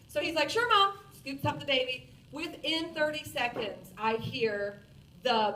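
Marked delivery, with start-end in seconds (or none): 0.5-1.0 high, bright "sure mom" like a son; eager kid voice. Retold or imitated. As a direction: imitate an eager son, higher and louder. Son answering mom's request.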